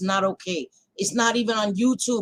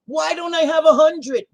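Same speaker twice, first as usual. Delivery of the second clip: higher mocking whine about viewer count; mock whiny streamer; 6 semitones higher, 4 dB louder than usual. Streamer mocks a count-obsessed creator, whiny. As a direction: mimic a petty streamer complaining. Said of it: "So I'm not like".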